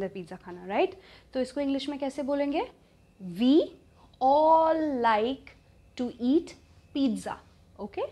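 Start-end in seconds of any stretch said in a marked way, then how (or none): 3.4-7.4 word by word, drawn out and emphatic; slow, spaced-out model sentence for learners; slow teaching model. Shown as a demonstration. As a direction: dictate it slowly, leaning on the first words.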